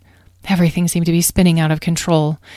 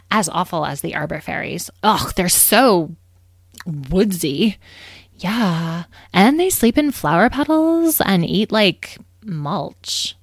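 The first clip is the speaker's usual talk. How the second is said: put-on drawling, sneering teenage-style disdain; snobbish, grossed-out complaint; disgusted young fairy. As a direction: let the last word drop in revulsion. Fairies sneer at rivals.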